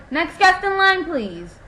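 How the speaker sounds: high, loud call across the queue. Retold or imitated. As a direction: call the next guest forward over the crowd. Calling the next guest forward.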